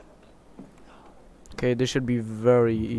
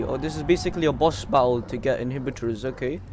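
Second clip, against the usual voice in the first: ordinary talk, nothing marked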